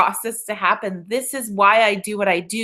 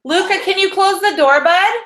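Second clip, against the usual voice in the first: much higher, louder call across the house; calling to son; 11 semitones higher, 5 dB louder than usual. Real, not acted. Mother calling her son mid-webinar to close the door.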